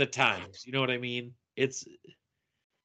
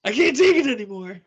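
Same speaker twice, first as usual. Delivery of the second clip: high, loud, fast mock outburst; movie quote outburst; 11 semitones higher, 7 dB louder, faster than usual. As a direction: belt out the quote like a kid losing it. Quoting Sandlot, shrill outburst.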